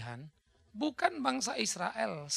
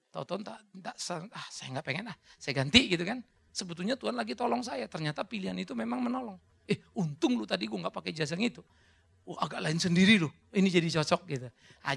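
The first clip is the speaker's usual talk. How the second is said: normal talking voice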